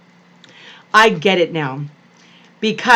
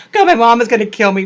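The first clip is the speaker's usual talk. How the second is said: usual speaking voice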